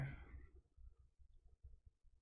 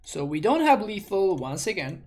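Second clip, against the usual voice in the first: louder, higher, exasperated; exasperated outburst; 6 semitones higher, 6 dB louder than usual. Real, not acted. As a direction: exclaim it in disbelief. Exasperated at no lethal again.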